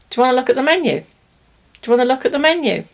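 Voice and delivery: quick, run-together casual question; natural connected speech. Shown as a demonstration. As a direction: say it fast like a waiter would. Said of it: "What it would sound like is".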